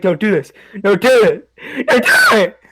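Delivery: put-on scared kid whimpering don't do it; panicked pleading. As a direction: beg her to stop, near tears. Panicking as sister summons Jeff.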